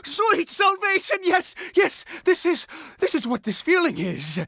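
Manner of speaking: high, rapturous exclaiming; laughing with wild relief; ecstatic relief. Acted. Father rejoices at salvation.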